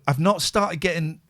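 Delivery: ordinary talk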